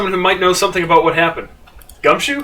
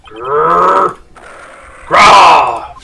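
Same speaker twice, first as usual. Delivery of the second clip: tiger growl building to roar; 5 dB louder than usual. Acted. Tiger growls then roars.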